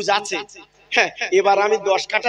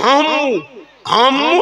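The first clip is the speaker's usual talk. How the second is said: imitating son-in-law; 4 semitones higher than usual. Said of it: "আর শাওরির কি বলে জানো?".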